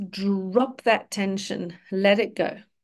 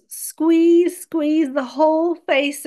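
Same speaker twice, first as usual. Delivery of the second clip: higher, louder coaching push; urging the squeeze; 5 semitones higher, 4 dB louder, slower than usual. Acted. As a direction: press on the word as if tensing along. Urging listeners to scrunch the face.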